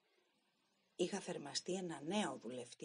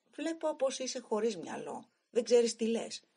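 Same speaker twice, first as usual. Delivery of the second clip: higher, much louder angry retort; angry rebuke; 6 semitones higher, 10 dB louder than usual. Acted. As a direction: shout him down in contempt. Driver rebukes jealous stoker.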